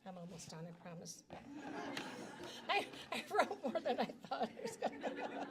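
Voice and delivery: soft, breathy laugh into sheepish words; embarrassed laugh. Real, not acted. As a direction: laugh through it, a bit embarrassed. Apologizes for a long speech.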